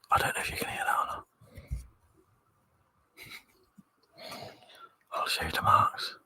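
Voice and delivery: soft breathy whisper into the mic; hushed ASMR whisper for the stream chat. Pretend. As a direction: whisper like you're lulling viewers to sleep. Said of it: "Would you like some A S M R?".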